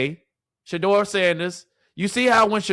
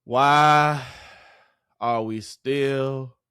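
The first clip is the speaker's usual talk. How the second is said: dragged-out outrage; 4 semitones lower, much slower than usual. Real, not acted. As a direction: drag it out, then hit the curse. Dragged-out rhetorical question, building.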